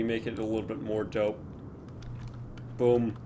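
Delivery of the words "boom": usual speaking voice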